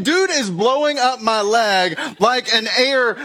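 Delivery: ordinary delivery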